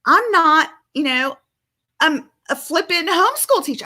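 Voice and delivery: husband's frustrated outburst. Retold or imitated. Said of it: "He's like".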